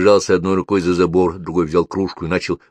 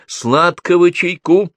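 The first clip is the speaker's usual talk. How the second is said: high, loud, drawn-out call; insistent holler for sweet tea; 11 semitones higher, 5 dB louder, much slower than usual. A narrator's reading. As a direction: sing out the order across a canteen. Canteen woman calls out for tea.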